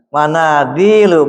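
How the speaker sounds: "Mana dilu" drawn out slowly to match the pattern; slow model reading. Shown as a demonstration. Teacher matching the word to its wazan, slowly. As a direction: stretch "Mana dilu" syllable by syllable. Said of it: "teacher matches word to wazan".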